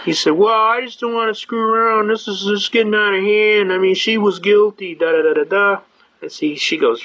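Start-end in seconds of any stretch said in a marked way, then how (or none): 0.3-5.8 high-pitched mock cop complaint; put-on higher voice, aggrieved; protesting cop. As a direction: imitate an officer griping to the judge.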